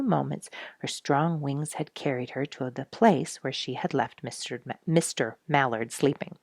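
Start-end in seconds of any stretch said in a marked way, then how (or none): none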